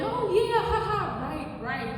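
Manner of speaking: high nervous giggle; awkward giggle. Retold or imitated. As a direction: laugh it off awkwardly. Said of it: "My awkward giggle".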